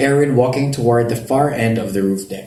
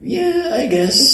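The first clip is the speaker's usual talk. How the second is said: awkward high admission; much slower than usual. Acted. Awkward answer about crush.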